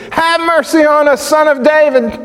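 very high cry at the top of the voice; shrieking plea. Retold or imitated. Blind men calling after Jesus, shrieking. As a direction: shriek for mercy. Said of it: "shrieked at the top of their voices".

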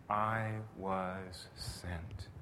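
very slow and soft, reverent; slow, solemn. Acted. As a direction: lay each word down gently. Slow quote of Christ.

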